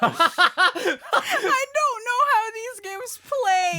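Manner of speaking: slow, laughing embarrassed admission. Real, not acted. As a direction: admit it while laughing helplessly.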